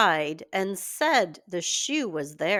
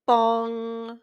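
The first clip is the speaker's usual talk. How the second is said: clock bong; 2 semitones higher than usual. Acted. Clock strikes midnight.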